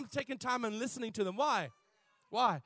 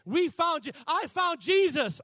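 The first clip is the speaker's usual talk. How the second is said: mock proud testimony; 7 semitones higher, 7 dB louder than usual. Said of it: "we're trained to say".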